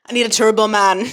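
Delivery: mock Austrian action-star accent; badly faked, hammed-up Austrian accent; Arnold Schwarzenegger. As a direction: put on a comic Austrian accent. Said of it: "sounded like Arnold Schwarzenegger in Jingle All the Way".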